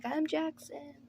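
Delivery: put-on shy young woman meeting her dad, said high and soft; lightly voiced nervous daughter; timid girl voice. Daughter introduces herself to dad.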